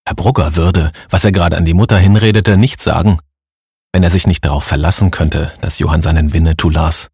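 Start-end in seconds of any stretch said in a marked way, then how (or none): none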